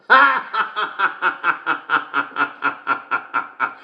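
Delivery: high, long staccato laugh; uproarious laugh. Acted. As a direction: burst out high and keep laughing. Cracks up at best joke.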